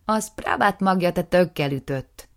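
loud, scornful retort; snapping put-down. Acted. Aftab snapping back at his brother, loud. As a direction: shut him down hard. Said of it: "torkolta le Aftáb".

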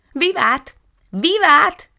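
high, loud chorus of cheers; a thirsty flower crowd roaring with joy; cheering shout. Acted. Peonies cheer the rain cloud together, loud and high. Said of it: "kiáltotta kórusban".

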